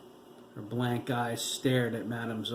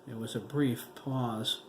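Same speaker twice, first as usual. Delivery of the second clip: plain reading voice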